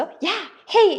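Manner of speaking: hammed-up shout to cut a friend off; mock yell. Hyori cutting off a pushy friend with a mock yell. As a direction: bark out the interruption. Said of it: "바로 고함을 지르죠".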